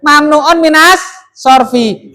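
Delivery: Mamnuun minas sorfi drawn out slow, minas leaned on hard; stressing the term. Shown as a demonstration. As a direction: spell out Mamnuun minas sorfi slowly, hitting minas. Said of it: "Tadi loh".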